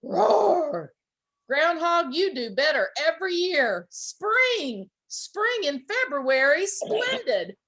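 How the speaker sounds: high, loud roaring bear; delighted bear roar. A narrator's reading. Bear woken up, roaring in delight. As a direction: boom it out gleefully. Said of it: "he roared in delight".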